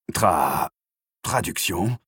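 lightly hoarse, halting question; a touch of croak, stumbling on the first syllable; voix rauque. A narrator's reading. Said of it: "d'une voix rauque". (voix légèrement rauque, hésitante)

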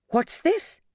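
high, curious "What's this?"; puzzled discovery; surprised question. Acted. Matron finds hidden money.

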